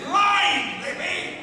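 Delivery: high, loud demand, fading off on the name; shouting for the line. Retold or imitated. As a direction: yell it out to the script supervisor. Actor demanding his line again.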